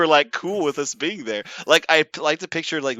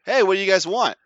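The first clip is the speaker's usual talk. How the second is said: a touch higher and louder, cheerful; eager worker voice; 6 semitones higher, 6 dB louder, faster than usual. Retold or imitated. Worker greets the students at the window, chipper. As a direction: greet the car like an eager host. Voicing the drive-thru worker.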